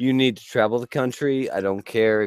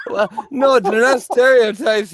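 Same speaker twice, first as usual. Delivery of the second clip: mock indignant protest; 10 semitones higher, 5 dB louder than usual.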